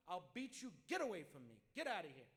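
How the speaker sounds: loud, dismissive "get away from me"; angry father. Acted. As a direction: drive the son off as the angry father. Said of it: "he told his son".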